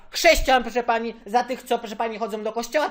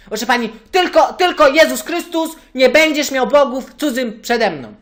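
loud ranting; 3 semitones higher, 8 dB louder than usual. Acted. Caller rants about commandments.

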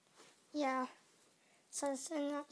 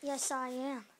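normal talking voice

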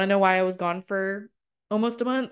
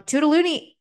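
high, louder, sing-song goodbye; playful sign-off; 9 semitones higher, 4 dB louder than usual. Real, not acted. Host signing off to her listeners, playfully high.

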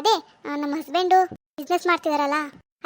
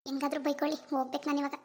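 much softer muttering; daughter-in-law muttering as she walks out; muttered aside; 9 dB quieter than usual. Acted. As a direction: grumble it under your breath. Muttering while leaving.

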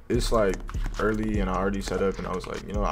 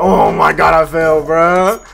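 screaming while falling; 6 semitones higher, 12 dB louder, slower than usual. Real, not acted. Just fell off the ledge.

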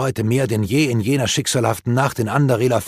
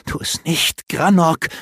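higher, urgent pleading; an elf girl urging her friend to hold back; 7 semitones higher, slower than usual. A narrator's reading. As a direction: beg him to stop. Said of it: "redete sie auf ihn ein".

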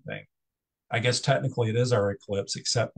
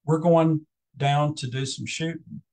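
mock Texan accent; 4 semitones higher than usual. Lecturer quoting an English woman's Texan impression, a mild mock Texan accent. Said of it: "she follows that up with".

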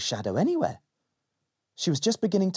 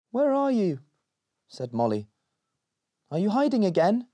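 put-on little girl voice; 8 semitones higher than usual.

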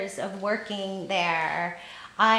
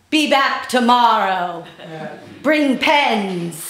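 loud, deliberate command; regal manager; 7 dB louder, slower than usual. Retold or imitated. Manager sizing up a new waitress, loud regal command. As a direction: issue orders grandly, with a pause between. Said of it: "she looked me up and down, and she said".

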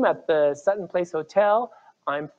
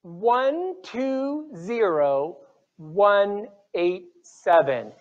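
raffle caller; much slower than usual. Acted. Calls out raffle numbers.

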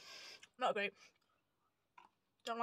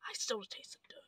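whispering; 2 semitones lower, 9 dB quieter than usual. Real, not acted. Whispering after tasting dirt.

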